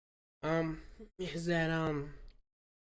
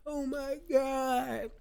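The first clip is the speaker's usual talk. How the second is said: slow, drawn-out, higher mock wail; mock teen despair; 9 semitones higher, 4 dB louder, much slower than usual. Vlogger imitating a teen forcing tears, mock slow wail. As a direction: stretch it out like a kid trying to cry.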